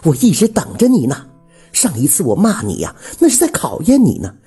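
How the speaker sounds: high-pitched, emphatic woman's confession; pleading widow. A narrator's reading. Widow confessing love through the door, pleading. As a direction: pour your heart out, pitched well up.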